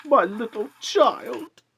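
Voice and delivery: high, loud, slow cry of grief; a father breaking down over his dead son. A narrator's reading. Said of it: "He broke down all at once".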